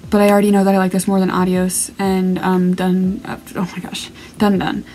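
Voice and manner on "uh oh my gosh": whispered. Real, not acted. Overwhelmed aside.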